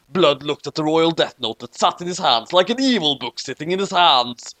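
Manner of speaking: usual speaking voice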